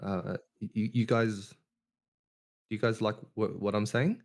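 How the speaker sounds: timid, stammering, rising on every phrase; imitating his shy school self. Speaker imitating his shy primary-school self, hesitant and stammering. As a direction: mimic the nervous kid he used to be. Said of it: "I'm gonna talk the way that I used to talk back when I was in primary school".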